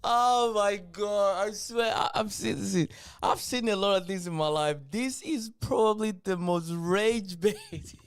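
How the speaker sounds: loud, slow, high disbelief at what he just saw; can't believe his ears; stunned disbelief. Real, not acted. Stunned reaction to clip.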